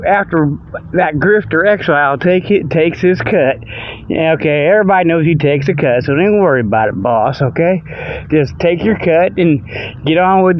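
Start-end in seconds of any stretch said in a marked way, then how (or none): none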